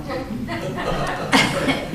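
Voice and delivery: quiet airy chuckle; soft breathy laugh. Real, not acted. Laughs at own joke.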